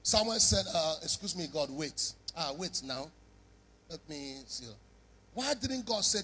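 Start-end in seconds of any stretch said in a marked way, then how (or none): 0.7-4.7 low, soft, brushing God off; hushed dismissive stalling. Acted. Samuel hushes God, asking him to wait. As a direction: quietly put him off, fading.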